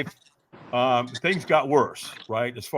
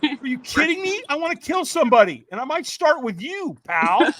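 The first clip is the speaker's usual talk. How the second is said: mock angry retort; 13 semitones higher, 3 dB louder than usual. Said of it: "I'm going".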